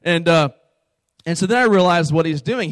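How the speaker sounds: ordinary delivery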